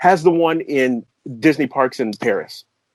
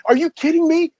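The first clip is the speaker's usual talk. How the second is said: giddy disbelief. Retold or imitated. Disbelief at contract.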